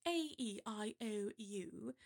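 vowels recited in received pronunciation; crisp RP vowel demo. Shown as a demonstration. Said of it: "with received pronunciation".

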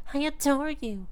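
ordinary talk, nothing marked

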